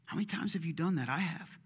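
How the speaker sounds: ordinary talk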